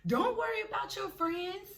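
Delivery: high-pitched breezy reassurance; imitated bouncer. Bouncer to stranded girl by the club, imitated reassurance. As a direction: voice a bouncer brushing off the worry.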